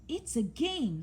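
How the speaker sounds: lightly squeaky little voice; a hint of a squeaky mouse. A narrator's reading. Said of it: "squeaked Mouse".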